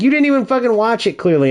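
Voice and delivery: high-pitched, quick-rising mock indignation; retold annoyance. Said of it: "He was even mad at me".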